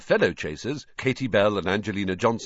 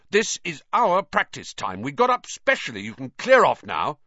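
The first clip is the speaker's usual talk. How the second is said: angry captain; 6 semitones higher, 5 dB louder than usual. A narrator's reading. Wood angry at Flint.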